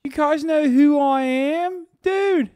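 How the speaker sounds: high, loud mimicked Baltimore accent. Streamer echoing a TV cop's line, mimicked Baltimore accent. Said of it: "he's not doing the accent in this".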